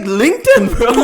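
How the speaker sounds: loud, high incredulous question; can't believe what he just heard; disbelief. Retold or imitated. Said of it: "I'm like".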